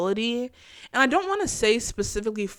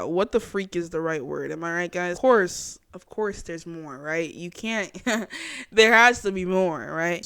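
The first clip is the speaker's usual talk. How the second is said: ordinary talk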